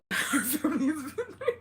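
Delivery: real high giggle; giggling fit. Real, not acted. Streamer losing it before a story, giggling. Breaks up while talking to viewers.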